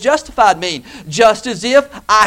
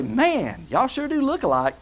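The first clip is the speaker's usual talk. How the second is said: soft, drawn-back aside of wonder; hushed amazement; 8 dB quieter than usual. Pretend. Preacher imagines comparing a believer with Jesus, hushed amazement. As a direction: marvel softly, under the voice. Acts out his future amazed remark.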